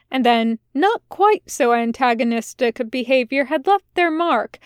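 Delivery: ordinary delivery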